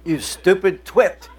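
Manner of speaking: slow, laughing mock insult. Preacher imagining a rude GPS insulting the driver, laughing. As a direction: sneer at the driver through a laugh. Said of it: "you expect the voice to say".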